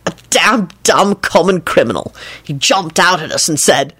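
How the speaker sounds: usual speaking voice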